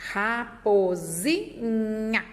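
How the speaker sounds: Raposinha drawn out slowly, syllable by syllable; teacher reading the word slowly to a child. Shown as a demonstration. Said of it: "ó".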